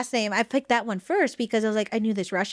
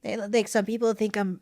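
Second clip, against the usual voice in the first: normal talking voice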